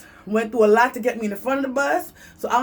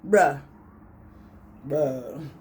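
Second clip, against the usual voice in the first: low, flat disbelief; deadpan bruh; 9 semitones lower than usual. Retold or imitated. Reacting to the man's demand, low and deadpan. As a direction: drop low and let it hang. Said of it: "I'm like".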